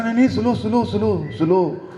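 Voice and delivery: high, urgent mock begging; panicked pleading. Customer begging masseuse to ease the hard pressure, mock panic.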